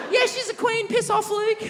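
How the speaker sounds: very high mocking girly voice telling him off; drunk girl retort. Drunk girlfriend telling Luke off across the road, very high squeal. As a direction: squeal it like a tipsy girl defending her mate.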